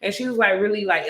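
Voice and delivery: ordinary talk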